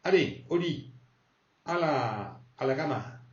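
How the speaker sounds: lightly voiced father, au lit; father sending to bed. Father telling the gamer kid to bed, mild stern. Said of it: "ton père qui ... qui te dit".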